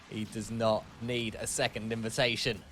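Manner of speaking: ordinary talk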